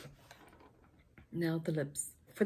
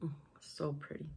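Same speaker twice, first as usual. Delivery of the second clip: whispered; 2 semitones lower, 6 dB quieter than usual. Real, not acted. Admiring lipstick softly.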